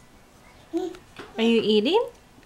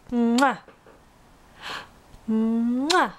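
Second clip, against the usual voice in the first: kiss sound. Pretend. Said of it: "Can you blow a kiss".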